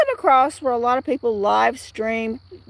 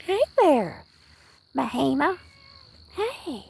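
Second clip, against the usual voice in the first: cooing at cat; 3 semitones higher, 5 dB quieter than usual. Real, not acted. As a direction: coo softly to a pet.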